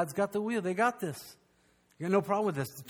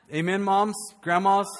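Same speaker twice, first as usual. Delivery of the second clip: ordinary talk, nothing marked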